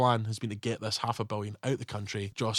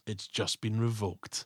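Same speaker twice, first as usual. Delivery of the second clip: slow, soft, deadpan line; underplay the cool comeback; badass one-liner; 4 semitones lower, 8 dB quieter, slower than usual. Cop delivering a calm, slow kill line. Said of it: "That's a badass line".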